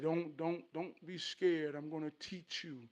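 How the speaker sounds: soft, lightly voiced dad; gently steady a frightened child; reassuring father. Father promising to teach his son, softly reassuring. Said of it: "So his daddy said".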